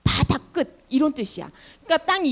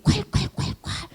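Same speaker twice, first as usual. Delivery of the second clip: breathy whooshing 콸콸콸콸, higher; gushing water sound; 8 semitones higher than usual. Acted. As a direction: imitate water bursting out.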